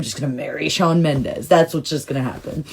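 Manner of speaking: usual speaking voice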